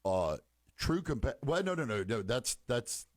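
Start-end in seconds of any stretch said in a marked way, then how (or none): none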